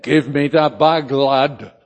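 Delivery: loud, gruffly brisk Lancashire order; broad Lancashire accent. Retold or imitated. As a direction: imitate a local in broad Lancashire. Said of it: "in his broad Lancashire accent".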